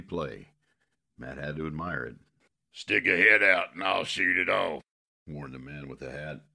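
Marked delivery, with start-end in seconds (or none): 2.7-4.8 loud, disguised croak. Acted. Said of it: "He spoke in a croak".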